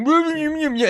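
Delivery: high, loud pretend munching; mumbled words round imagined food; mock mouthful of food. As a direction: try to answer through a mouthful. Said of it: "has a mouthful of food".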